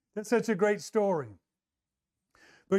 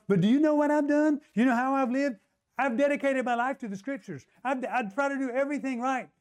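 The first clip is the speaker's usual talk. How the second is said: protesting Nicodemus; 6 semitones higher than usual. Acted. Nicodemus to Jesus, listing his good deeds indignantly. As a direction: defend yourself, stung and insistent.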